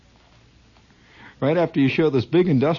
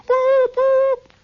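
whistle boops; 6 dB louder than usual. Retold or imitated. Imitated whistle.

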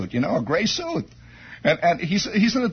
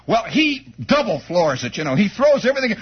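normal talking voice

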